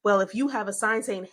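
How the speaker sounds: ordinary talk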